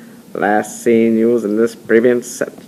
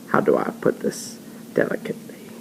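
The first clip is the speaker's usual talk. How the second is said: a dropped, whispered aside; hushed aside; 12 semitones lower, 5 dB quieter than usual. Acted. Gus softly teasing at the dinner table, whispered aside. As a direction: lower the voice to a near whisper as if being tactful.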